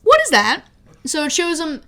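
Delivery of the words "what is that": mock surprise. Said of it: "she's like".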